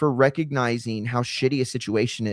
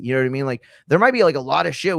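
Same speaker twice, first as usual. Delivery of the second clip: ordinary talk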